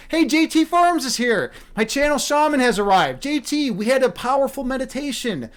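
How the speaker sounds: light up as a friend drops into the stream; excited greeting. Real, not acted.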